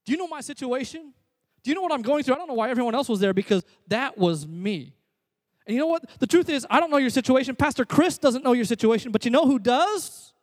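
loud, high, driving preacher voice; full-force preaching. Acted. Pastor drilling HALT into the congregation, full-force preaching. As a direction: preach it hard and build to each point.

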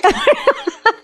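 short, high burst of real laughter; bright giggle bursting out. Real, not acted. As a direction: laugh out at the sheer audacity of it. Laughs at going on faith.

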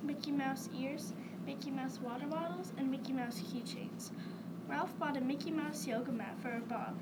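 ordinary delivery, nothing marked

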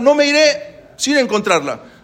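high, loud and slow insistence; retold shout. Grandfather refusing to leave without her, slow loud shout. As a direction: plant your feet and yell it out slowly. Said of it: "Empezaron a gritar".

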